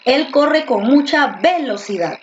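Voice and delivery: "velocidad" stressed on the first syllable, VE-locidad. Shown as a demonstration. Teacher trying a sample sentence, "velocidad" wrongly stressed on VE. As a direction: punch VE as "velocidad" begins. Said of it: "Porque tú dices".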